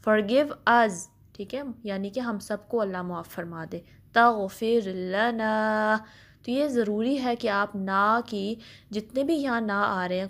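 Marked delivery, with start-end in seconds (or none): 4.1-6.0 very slow drawn-out chant; slow tajweed recitation; Quranic recitation. Shown as a demonstration. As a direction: recite it slowly, stretching the vowels. Recites phrase slowly.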